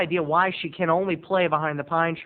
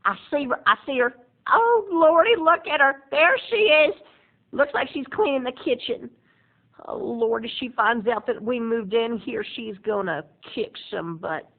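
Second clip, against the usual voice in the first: gossipy aunt; 5 semitones higher than usual. Retold or imitated. Aunt spying on Roberta's house, high and giddy. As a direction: gasp and peer like a nosy aunt at the window.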